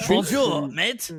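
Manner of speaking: French host attempting an Australian accent; French-Australian mock accent. Co-host playing French podcaster, mock French-Australian accent. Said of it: "French podcast trying to do an Australian accent".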